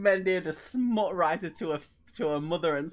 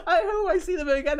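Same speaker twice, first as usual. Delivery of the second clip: high, loud, laugh-choked voice; nearly crying with laughter, easing off; 12 semitones higher, 7 dB louder than usual. Real, not acted. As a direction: push the words out through laughter. Still laughing at the story.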